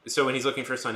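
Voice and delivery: normal talking voice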